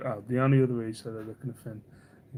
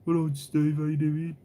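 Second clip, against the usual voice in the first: Brummie greeting; 4 semitones higher, 4 dB louder than usual. Retold or imitated. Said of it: "I sound like I'm constantly, like, drugged up".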